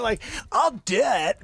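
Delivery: loud, slow insistence; big mock-indignant I'll do it; emphatic. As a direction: plant your feet and insist it. Mock-offended about being chanted in.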